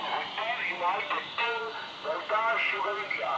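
faint signal fading out; radio breaking up. Real, not acted. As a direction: voice sinking into the radio static.

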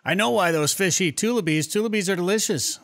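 usual speaking voice

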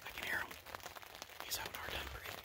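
hushed, unvoiced-sounding whisper from the blind; excited news kept to a whisper; hunter's whisper. Real, not acted. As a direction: whisper low so the animals don't hear.

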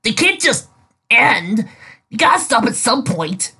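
high, insistent disbelief; arguing. Acted. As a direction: argue it won't end. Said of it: "Rainbow argued".

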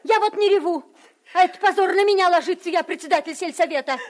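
high, loud furious scolding; angry shout. Acted. Scolding a crying girl.

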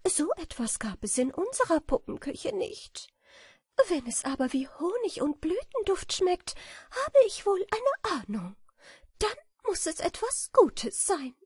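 put-on high, light, dreamy girlish voice; a naive little butterfly imagining honey; sweet and high; innocent butterfly. A narrator's reading.

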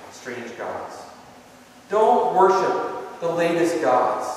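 ordinary talk, nothing marked

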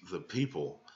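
usual speaking voice

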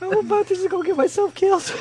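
very high, squeaky laughing voice; helpless laughter. Real, not acted. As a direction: squeal the words through giggles. Helpless laughter during the game.

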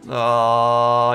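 usual speaking voice

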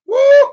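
high whooping shout of joy; sudden praise whoop; joyful woo. Real, not acted. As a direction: let out a soaring woo.

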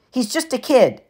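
lightly voiced poker buddy, mild; calm him down casually; Eddie. A narrator's reading. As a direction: friend Eddie telling Gabe to ease off. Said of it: "his friend Eddie interrupted".

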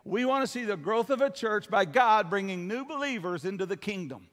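usual speaking voice

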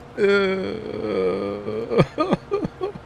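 long high laugh breaking out; helpless laugh. Real, not acted. Streamer failing a word for chat, helpless laughing. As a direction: crack up at your own fumble. Cracking up at own failed word.

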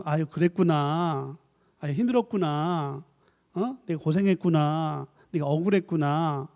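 soft, slow, soothing parent; tender comforting. Shown as a demonstration. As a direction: comfort the crying child gently. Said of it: "그런 구나를 들어야 되는데". (우는 아이를 달래며 천천히 부드럽게 공감하는 말투)

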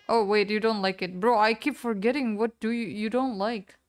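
ordinary talk

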